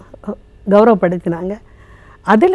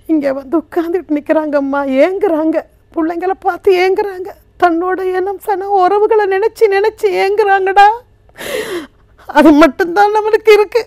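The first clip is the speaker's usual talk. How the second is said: acted anguished mother, pitched high in bursts; pleading mother; 4 semitones higher than usual. Care-home mother blessing her son and describing forsaken mothers, pleading. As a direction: swell with longing, then reassure him. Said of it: "அந்த தாய் பேசுற மாதிரி கதைச்சேன்".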